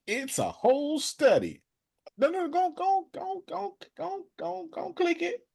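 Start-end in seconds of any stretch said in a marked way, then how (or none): 2.2-5.4 much higher, eager go go go; excited urging. Real, not acted. Hurrying Deanna to click the link, excited high urging. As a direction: push her to click, voice up high.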